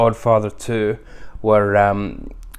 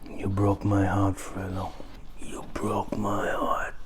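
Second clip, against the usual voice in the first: soft, then whispered slowly; hushed quote; 2 semitones lower, 10 dB quieter, slower than usual. Retold or imitated. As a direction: breathe the last line as a whisper. Quoting [name] line softly.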